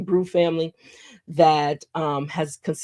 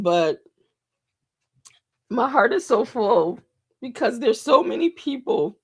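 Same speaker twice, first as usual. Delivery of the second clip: higher voice, full of real emotion; choked up; 4 semitones higher than usual. Real, not acted. Host telling followers how full the heart is, choked up. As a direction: hold back tears through the words. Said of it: "I just need a moment".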